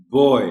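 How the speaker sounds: बॉय का सही उच्चारण, साफ़ ऑय; correct boy. Shown as a demonstration. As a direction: say Boy with a clear open oy. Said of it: "correct pronunciation of the word is". (Boy साफ़ ऑय के साथ)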